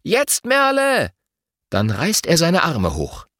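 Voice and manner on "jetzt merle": high, drawn-out cue to a teammate; storybook shout, slow and high; calling out. A narrator's reading. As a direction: stretch the call, pitched way up. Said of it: "Luis fasst Lottas Hände fester und ruft".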